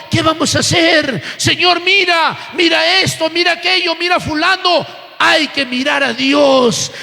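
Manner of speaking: preacher building through a mounting list; fervent sermon with driving repetition; impassioned preaching. Real, not acted. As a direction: pile up the pleas, then proclaim the answer firmly. Preaches at full force, voicing anxious complaint.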